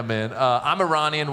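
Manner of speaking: usual speaking voice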